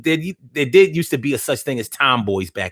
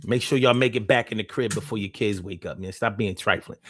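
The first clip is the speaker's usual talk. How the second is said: ordinary talk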